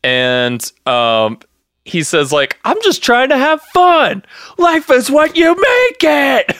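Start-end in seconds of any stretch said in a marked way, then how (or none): 2.6-6.5 mock unhinged yell. Imitating Donnie losing his shit.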